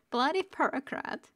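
high voice just after a giggle; amused repeat. Real, not acted. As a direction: repeat it high, tickled by the phrase. Reader repeats line, amused.

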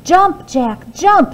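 high, loud urgent shout; hollering. Acted. Mother urging Jack to jump, hollering. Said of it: "she hollered".

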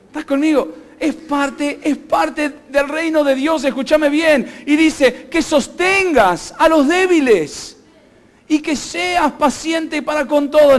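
high, loud, drawn-out preacher's delivery; forceful preaching. Acted. Pastor reading the church what the Bible demands, forceful preaching. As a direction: preach it out with force, stretching key words.

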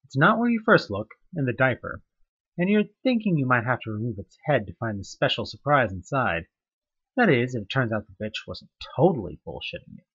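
ordinary talk, nothing marked

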